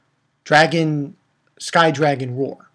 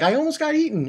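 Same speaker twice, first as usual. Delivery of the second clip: put-on girly whine; 12 semitones higher, faster than usual. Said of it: "and she's like".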